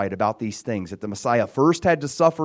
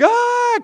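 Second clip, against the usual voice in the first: high, loud freak-out "Ah!"; sudden high-pitched mock yell of terror; mock terrified scream; 7 dB louder than usual. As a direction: imitate disciples screaming in fright. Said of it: "they're freaking out. They're all terrified".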